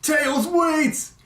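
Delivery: high, innocent-sounding mock plea; Doctor Eggman. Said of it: "he has this innocent sound like".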